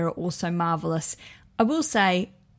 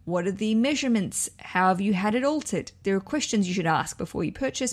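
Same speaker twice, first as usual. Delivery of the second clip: usual speaking voice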